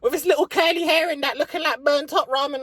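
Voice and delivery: squeaky mock complaint; mock whining viewer. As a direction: sneer it like a bitter viewer. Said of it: "I bet you're bitching right the fuck now".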